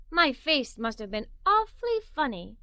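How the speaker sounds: ordinary delivery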